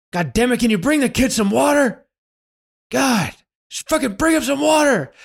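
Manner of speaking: high, strained mock shout; exasperated Clint Eastwood. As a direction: yell it fed up, as if it's getting to you. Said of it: "it's, like, getting to Clint Eastwood".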